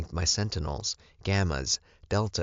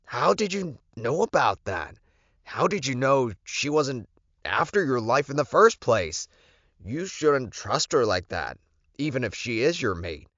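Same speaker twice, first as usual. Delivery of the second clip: lightly voiced Lorenzo, higher and doubting; a hint of a wary advisor; suspicious beta; 8 semitones higher than usual. Lorenzo questioning the Alpha's trust in Alyssa, mildly suspicious.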